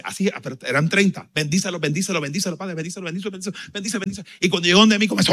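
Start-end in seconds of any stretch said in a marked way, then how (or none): 1.3-4.2 quieter, higher murmured blessing, repeated fast like a chant; imitating a praying pastora. Pastora laying hands, murmuring blessings softly and higher. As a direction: lightly mimic a pastora blessing thirty pastors in a row.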